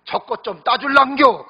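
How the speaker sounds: country wife calling out in dialect; Gyeongsang bride. Retold or imitated. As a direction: Gyeongsang bride calling husband about the flower. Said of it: "경상도 신부가 말합니다".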